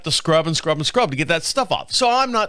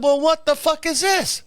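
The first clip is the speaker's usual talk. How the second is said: baffled outburst; 10 semitones higher than usual. Retold or imitated. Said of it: "I said".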